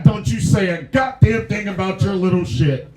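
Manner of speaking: loud laughing mock scold. Scolding a heckler in the front row, loud and laughing. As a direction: tell him off, laughing.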